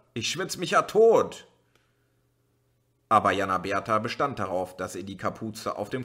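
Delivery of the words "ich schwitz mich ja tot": high, loud outraged protest; indignant. A narrator's reading. Uli protesting indignantly at his sister. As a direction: protest like a fed-up child. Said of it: "rief er empört".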